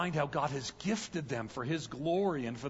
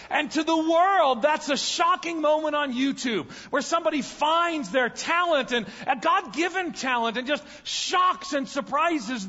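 higher, louder preaching build, pressing the call; preaching at full force; 10 semitones higher, 6 dB louder than usual. Acted. Preaching climax.

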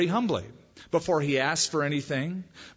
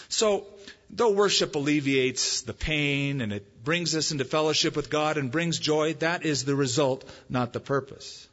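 ordinary delivery

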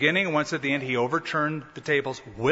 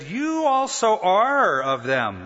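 slow, drawn-out accusation, pitched up; accusing; 5 semitones higher, 3 dB louder, much slower than usual. Acted. Another onlooker accuses Peter by the fire, accusing. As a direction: point the finger, stretching each word.

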